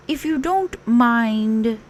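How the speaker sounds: gently asking; Pooh voice. A narrator's reading. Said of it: "he said".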